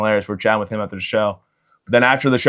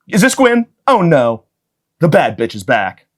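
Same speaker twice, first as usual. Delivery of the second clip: mock panic; 5 semitones higher, 4 dB louder, slower than usual. Cops panicking about the mom.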